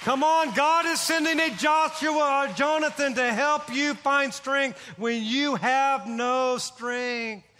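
rally the congregation, driving each phrase; full-force preaching. Acted. Pastor rousing his church about a Jonathan, preached full force.

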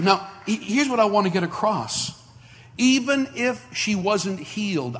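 normal talking voice

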